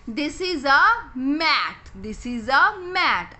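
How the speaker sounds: "mat" drawn out very slowly, each word separate. Shown as a demonstration. Teacher to kids, naming the picture very slowly. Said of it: "अब हमने मैट कैसे लिखना है".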